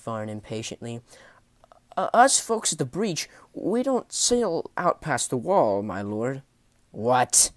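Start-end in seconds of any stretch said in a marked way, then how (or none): 2.0-6.4 high, hesitant, stretched-out sailor voice; nervous sailor. Acted. Nervous sailor answers a menacing lord. 6.9-7.5 loud, tense one-word demand; through clenched teeth. Acted. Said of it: "said Jet Varn through clenched teeth".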